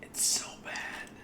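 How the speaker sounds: slow, voiceless whisper; hushed slow lament; despairing whisper. Real, not acted. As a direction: whisper it, utterly defeated. Hiccup despair.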